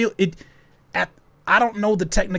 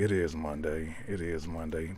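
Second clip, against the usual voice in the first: muttered low; 10 semitones lower, 11 dB quieter than usual. Real, not acted. Low soft mumble repeat.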